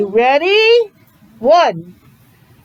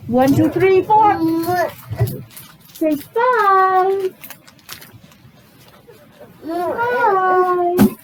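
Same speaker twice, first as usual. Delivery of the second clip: coaxing count; 7 semitones higher, much slower than usual. Shown as a demonstration. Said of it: "Which one comes next?".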